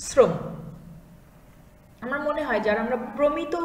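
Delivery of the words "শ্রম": শ্রম said as srom with a sharp s; dental s demo. Shown as a demonstration. Teacher demonstrating শ্রম for the class. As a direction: say শ্রম with a crisp s. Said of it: "দন্ত স দিয়ে".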